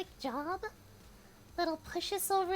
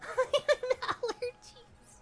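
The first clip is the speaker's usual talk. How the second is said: laughing; 5 semitones higher, slower than usual. Real, not acted. Laughing at chat teasing.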